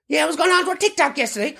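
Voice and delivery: high-pitched gossip; nosy local voice. Pretend. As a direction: gossip like a village busybody.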